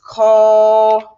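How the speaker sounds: syllable by syllable. Shown as a demonstration. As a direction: sound out co-ma-mos-la as if writing it. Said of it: "it would look like this".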